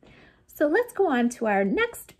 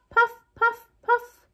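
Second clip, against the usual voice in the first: high, louder puffing like an engine; chugging puffs; 13 semitones higher, 4 dB louder than usual. Shown as a demonstration. Teacher puffing like a tractor robot.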